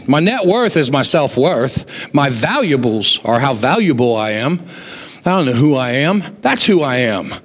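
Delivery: normal talking voice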